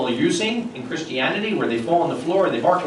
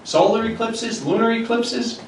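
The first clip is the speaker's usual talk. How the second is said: ordinary talk, nothing marked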